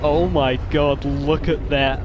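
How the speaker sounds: loud, thrilled gasp of delight; excited outburst. Real, not acted. Gamer watching his dinosaur charge, loud excited outburst. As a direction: burst out watching it sprint.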